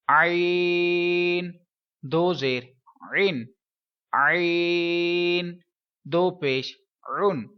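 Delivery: عین stretched very long, عن from the throat; slow recitation of عین; spelling drill. Shown as a demonstration. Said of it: "کیسے؟". (عین کو کھینچ کر حلق سے پڑھنا)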